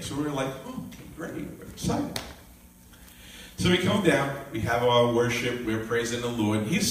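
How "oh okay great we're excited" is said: hushed, half-whispered "great"; unenthused acting excited. Family answering dad's news at dinner, hushed and unenthused. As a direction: say it under your breath, almost voiceless. Feigned enthusiasm about dad coming.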